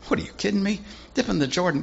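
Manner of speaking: high indignant outburst; offended general scoffs at the prophet's order; ticked off. Acted. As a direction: scoff at the insult, voice shooting up. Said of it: "And Naaman just gets ticked off".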